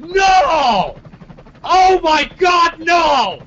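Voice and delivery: high panicked cries of no; panicked screaming. Acted. Gunned-down fighter screaming in panic, high cries. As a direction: scream in terror as bullets hit.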